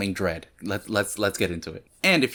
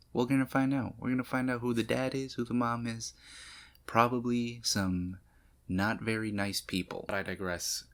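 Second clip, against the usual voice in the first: hushed tease; 8 dB quieter than usual. Real, not acted. Trailing conspiratorial tease.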